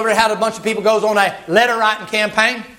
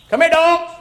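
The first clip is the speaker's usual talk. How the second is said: much higher call to the dogs; 9 semitones higher than usual. Retold or imitated. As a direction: summon the dogs to the body. Calling dogs to Jezebel.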